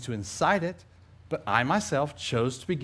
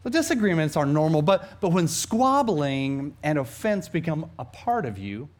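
ordinary talk

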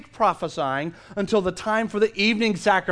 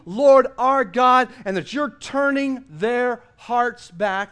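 raised, higher, measured plea to God; impassioned prayer; 5 semitones higher, 5 dB louder than usual. A narrator's reading. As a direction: pray aloud fervently, swelling on the appeals. Elijah's public prayer, acted aloud.

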